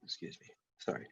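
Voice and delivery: whispered aside, excusing herself; quick hushed excuse me; whispered apology. Real, not acted. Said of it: "dry mouth".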